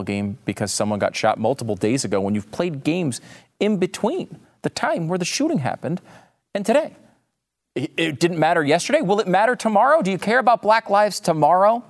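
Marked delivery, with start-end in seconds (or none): 3.6-11.8 heated rant. Real, not acted. Ranting about players skipping games.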